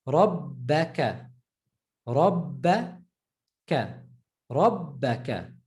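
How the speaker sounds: Rabbaka, slow, the doubled b held and stressed. Shown as a demonstration. Teacher to students demonstrating the doubled b slowly. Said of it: "Shut down the ba".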